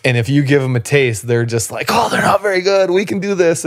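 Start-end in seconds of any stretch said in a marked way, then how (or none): 1.9-3.7 high-pitched imitation of hopeful underdogs; mock eager confidence. Underdog opponents who got a taste, mock eager confidence. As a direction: pitch it way up, playing suddenly encouraged opponents.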